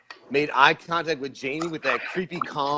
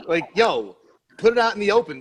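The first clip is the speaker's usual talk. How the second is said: normal talking voice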